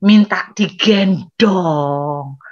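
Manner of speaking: very slow and low, drawn out; heavy, lingering delivery; dramatic slow emphasis. Acted. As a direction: stretch each word with comic dread. Dreading a big child's demand.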